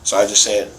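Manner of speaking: ordinary talk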